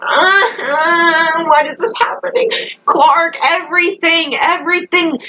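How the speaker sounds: high, wailing fan despair; distressed whine. Real, not acted. Fan despairing over Clark's choices, high whining wail. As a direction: whine it out in mock agony.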